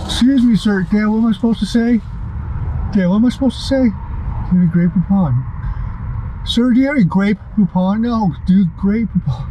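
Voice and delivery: mock clueless friend. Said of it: "That's what R J would do".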